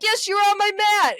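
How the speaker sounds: high, loud mock scolding; mock angry yell. Angry man accusing his neighbour, mock yell. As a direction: insist angrily, hammed-up. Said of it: "He's like".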